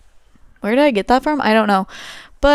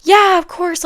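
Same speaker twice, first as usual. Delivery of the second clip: high, bright people-pleasing yes; sugar-coat it like a nice girl; overly sweet; 9 semitones higher, 6 dB louder than usual. Retold or imitated. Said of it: "I was totally so sweet".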